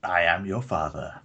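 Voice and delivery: deadpan Vader line, drawn out and hushed; Darth Vader quote. Pretend. Co-host greets the show as Darth Vader, mock ominous.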